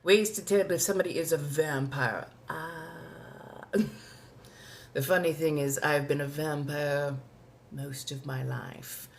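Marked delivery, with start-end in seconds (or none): none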